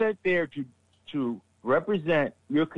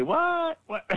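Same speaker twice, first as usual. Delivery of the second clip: baffled what; 14 semitones higher than usual. Retold or imitated. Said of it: "Sickles say".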